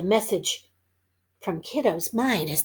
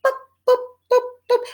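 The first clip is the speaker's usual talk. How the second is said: playful boops; 13 semitones higher, 4 dB louder, much faster than usual. Acted. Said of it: "just basically say".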